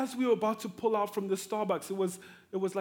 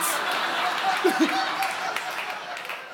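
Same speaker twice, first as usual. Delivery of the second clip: big laugh; 9 semitones higher, 3 dB louder than usual. Real, not acted. Gleeful about cropping daughter out.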